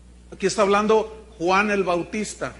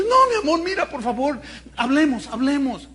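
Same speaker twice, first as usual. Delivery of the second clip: begging husband; 7 semitones higher, 5 dB louder than usual. Acted. Husband begs wife.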